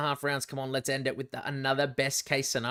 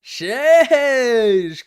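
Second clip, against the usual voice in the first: excited exclamation; 9 semitones higher, 13 dB louder than usual. Real, not acted. Reacting to the commentator's chant.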